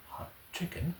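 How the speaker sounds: usual speaking voice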